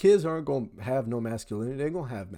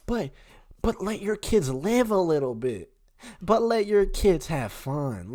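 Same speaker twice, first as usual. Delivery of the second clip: higher, whiny imitation of critics; mocking quote; 7 semitones higher, 5 dB louder than usual. Host mocking critics of the dad, whiny. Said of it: "I just don't understand how people".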